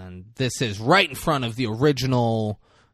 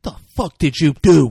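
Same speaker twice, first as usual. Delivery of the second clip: loud, raised-pitch accusation; mock angry yell; 5 semitones higher, 11 dB louder than usual. Pratt to Howard over dead long necks, mock outrage. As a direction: demand it loudly, acting out outrage.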